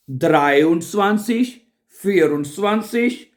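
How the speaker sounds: ordinary talk, nothing marked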